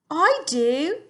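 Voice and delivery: young Sophie in a put-on English accent. Teacher reading to her class as Sophie, English accent. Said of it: "an English accent".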